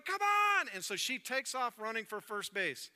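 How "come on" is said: high, loud urging yell; coach shouting. Acted. Coach urging the batter toward first, high loud shout. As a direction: holler it like an excited coach. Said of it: "the coach is going".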